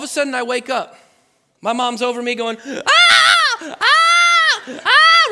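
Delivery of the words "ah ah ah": high, loud frantic cries; panicked screams. Retold or imitated. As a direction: scream in terror over your kid. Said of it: "She's freaking out".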